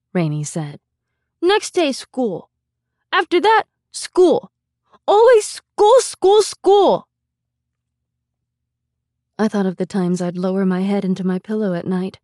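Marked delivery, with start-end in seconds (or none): 1.4-7.0 put-on high child voice, building louder; whiny toddler piling up grievances; complaining little boy. A narrator's reading. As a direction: escalate into loud, high childish protest. Small boy's complaint builds.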